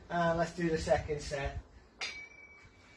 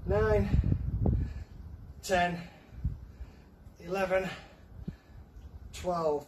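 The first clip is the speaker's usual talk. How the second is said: counting reps, louder and higher toward the end; counting workout reps; 4 semitones higher, 3 dB louder, slower than usual. Real, not acted. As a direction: push them through the last reps. Coach pushing through last reps.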